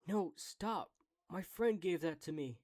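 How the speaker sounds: high, loud acted plea; urgent shout. Calling out to the creature to stop, urgent shout. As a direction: call out to stop a thief.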